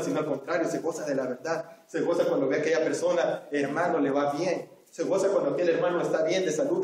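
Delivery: usual speaking voice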